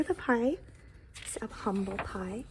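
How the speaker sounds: ordinary delivery, nothing marked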